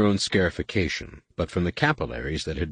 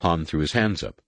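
usual speaking voice

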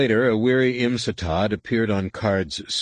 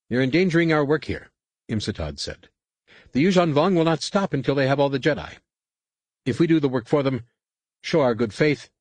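higher, fast, pressing officer; a tense enemy captain arguing over the comm, higher and faster; Imsatad, hostile captain; 8 semitones higher than usual. A narrator's reading. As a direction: push your case quickly and insistently.

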